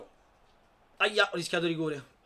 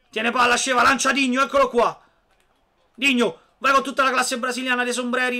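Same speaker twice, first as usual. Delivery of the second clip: lively sports commentary, raised; play-by-play commentary; 6 semitones higher, 8 dB louder than usual. Real, not acted. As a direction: call the action as it happens. Live play-by-play of own match.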